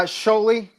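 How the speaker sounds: "Scioli" in two syllables, SHOH-lee; silent I. Shown as a demonstration. Guest showing host his surname, "Scioli" said SHOH-lee. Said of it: "How do I say it".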